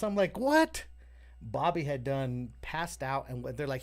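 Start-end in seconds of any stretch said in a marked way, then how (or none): 0.4-0.8 high, loud disbelieving what; shocked what. Retold or imitated. Told his boy died, a high shocked what. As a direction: jolt up in disbelief. Hearing his friend stopped breathing.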